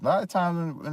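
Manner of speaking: ordinary talk, nothing marked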